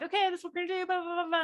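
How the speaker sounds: high-pitched rapid patter; fast talk. Retold or imitated. Host briskly opening a Chicago client meeting, fast talk. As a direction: rattle it off to get the meeting going. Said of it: "and I talk fast".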